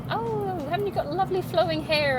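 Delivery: high, sugary mock compliment; gushing flatterer. Girls hitting on the speaker, high mock gushing. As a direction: lay the flattery on thick like a suck-up. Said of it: "and they'll, and they'll".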